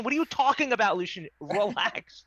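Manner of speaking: high, loud alarmed plea. Acted. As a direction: snap him out of it. Calming a possessed friend.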